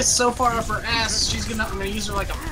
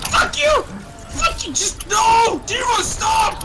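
very high-pitched repeated cursing; high, frantic gamer rage; exasperated screaming; 12 semitones higher than usual. Real, not acted. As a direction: yell in rising frustration at an opponent.

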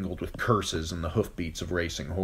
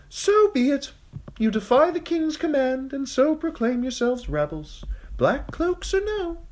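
high thin voice; 4 dB louder than usual. Acted. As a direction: command the gates open, high and thin. Sir Amory proclaims them rebels.

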